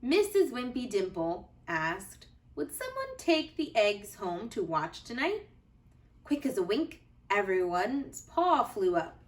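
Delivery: put-on gentle, lively read-aloud for children; cozy storybook narration for little listeners; bedtime story reading. A narrator's reading. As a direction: read it like a bedtime picture book.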